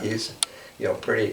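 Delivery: usual speaking voice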